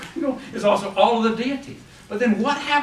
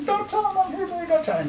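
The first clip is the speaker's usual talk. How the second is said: put-on frightened child; 12 semitones higher, faster than usual. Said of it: "And, you know".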